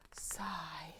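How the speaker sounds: very soft, airy whisper; whispered sigh. Acted. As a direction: let the word out as a hushed sigh.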